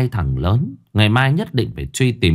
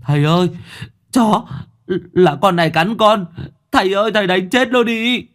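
put-on a little boy begging his father to kill the dog; young son crying out to his father; child crying out; 9 semitones higher than usual. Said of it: "chạy ra kêu lên".

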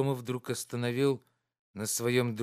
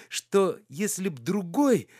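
higher, slower, a touch of dread; horrified; 7 semitones higher, 4 dB louder, slower than usual. A narrator's reading. Oblomov imagining another fate, mildly horrified. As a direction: recoil at the thought, slowing down. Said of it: "с ужасом прибавил он".

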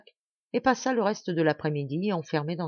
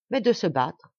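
a touch of rude bluntness; rude to coarseness; 4 dB louder than usual. A narrator's reading. Maurice to Martial, demanding a duel, mildly rude. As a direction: say it bluntly, with mild rudeness. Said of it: "dit-il d'un ton rude, jusqu'à la grossièreté".

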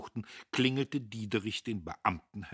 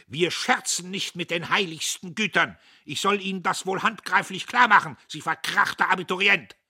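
loud, higher, furious rebuke; angry outburst; 11 semitones higher, 12 dB louder than usual. Acted. Dietrich angrily scolds the editor: furious shout. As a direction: bellow the insult at him like a man offended to the core. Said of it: "Da ward Dietrich zornig".

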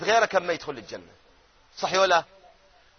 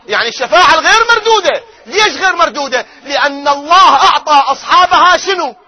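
fervent preaching; 7 semitones higher, 8 dB louder than usual. Real, not acted.

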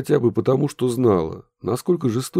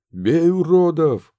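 slow loud battle cry; yelling; 8 semitones higher, 6 dB louder, slower than usual. Acted. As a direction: bellow it as a war cry. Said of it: "завопили лабухи".

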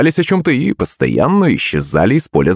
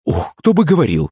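higher-pitched indignant retort; exclaiming; 7 semitones higher than usual. A narrator's reading. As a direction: throw it back with an exclamation. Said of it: "воскликнула Маман". (с возмущённым возгласом)

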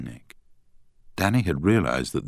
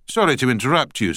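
put-on Scottish accent; 8 semitones higher, 4 dB louder, faster than usual. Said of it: "in a strong Scottish accent".

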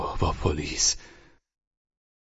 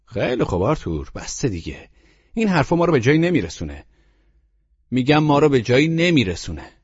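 harsh, louder rebuke to Arthur; 9 semitones higher, 5 dB louder than usual. Acted. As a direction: cut him off roughly. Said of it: "با خشونت گفت". (با خشونت و صدای بلند به آرتور)

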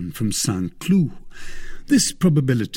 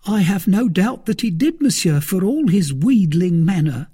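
higher, louder anxious servant voice; a jittery valet blurting out news; frightened servant Planchet; 9 semitones higher, 6 dB louder than usual. A narrator's reading.

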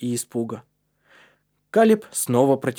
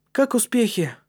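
put-on boy asking; 10 semitones higher than usual. A narrator's reading. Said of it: "спросил Маттиас".

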